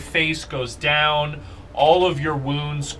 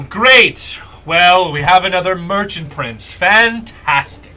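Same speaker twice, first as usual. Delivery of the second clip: Akene, bitterly sarcastic; 2 semitones higher, 3 dB louder than usual. Acted. Merchant prince sneers at Chucky the new prince, sarcastic. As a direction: spit mock congratulations at the winner. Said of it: "you guys hear Akene go".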